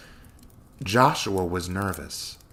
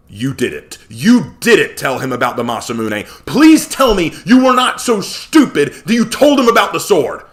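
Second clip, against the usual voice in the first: loud, high panicked accusation; rising horror, pleading; horrified; 8 semitones higher, 12 dB louder than usual. Acted. Joshua horrified that Connor told about the sword. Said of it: "anger suddenly turned to horror".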